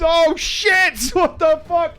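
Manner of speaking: high, laughing replay of a startled swear; shocked swearing. Retold or imitated. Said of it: "right fucking loose".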